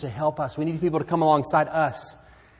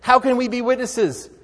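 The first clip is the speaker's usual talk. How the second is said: eager question; 10 semitones higher, 7 dB louder than usual. Retold or imitated. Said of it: "they're saying".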